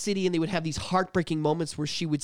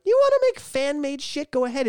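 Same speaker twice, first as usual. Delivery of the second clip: mock company voice; 9 semitones higher than usual. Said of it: "they're like".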